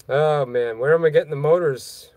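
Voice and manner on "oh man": usual speaking voice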